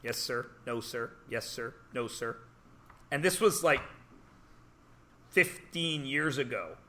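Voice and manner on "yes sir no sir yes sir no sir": soft, terse yes sir no sir; softer clipped politeness; curt polite replies. Retold or imitated. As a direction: mumble it quietly and stiffly. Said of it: "Everyone's like".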